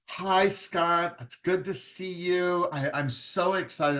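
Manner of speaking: mock-eager writer greeting the agent; eager author. Nervous writer greeting the agent at a conference, mock eager. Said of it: "they sit down and they go".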